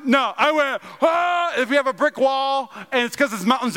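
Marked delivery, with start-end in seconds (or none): 1.0-1.5 high mock yell of rage; acted angry yell. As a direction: re-enact the throwing yell. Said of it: "I had this anger".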